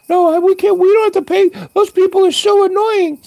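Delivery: mocking the complaining diners; cranky old lady. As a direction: squawk it like an indignant granny. Complaining old diners refusing to pay.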